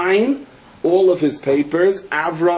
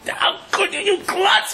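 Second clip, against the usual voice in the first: very high, strained, outraged scolding; acted anger; slower than usual. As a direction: rage at a hopeless younger brother. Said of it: "brother is so angry at him".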